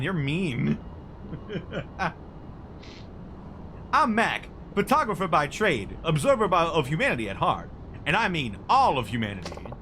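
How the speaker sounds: high, loud, gushing photographer voice; an over-eager paparazzo spotting his big shot; excitable photographer. Acted. Mac voice again.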